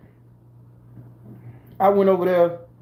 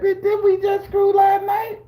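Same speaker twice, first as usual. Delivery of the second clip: much higher, outraged disbelief; incredulous retort. Retold or imitated. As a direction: fire it back in indignant shock. His indignant inner retort.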